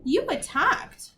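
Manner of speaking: high, slow, smug accusation; smiling taunt. A narrator's reading. Sophie taunts the attackers with the rules, smiling. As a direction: gloat sweetly with a smile. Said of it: "she smiled".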